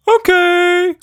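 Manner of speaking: very high, loud little creature voice; a cheeky tiny monster, full of glee; Quatch Monster voice. Acted.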